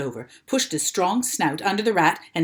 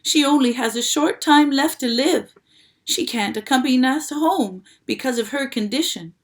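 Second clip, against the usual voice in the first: loud, higher desperate plea; urgent pleading; 5 semitones higher, 6 dB louder than usual. A narrator's reading. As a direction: beg him to hurry.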